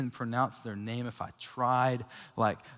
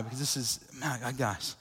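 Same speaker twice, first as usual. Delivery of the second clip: holding back tears; 7 dB quieter than usual. Real, not acted. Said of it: "I gotta really try not to cry".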